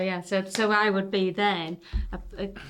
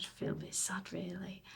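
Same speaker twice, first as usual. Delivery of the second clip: near-whispered, much softer, almost no voice; quiet sad admission; 13 dB quieter than usual. Retold or imitated. Telling the old lady on the bus he feels sad, near-whispered. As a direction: confide it under your breath, a bit sheepish. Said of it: "a bit stupid and sad in my leathers".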